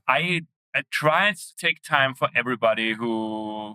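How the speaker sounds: ordinary delivery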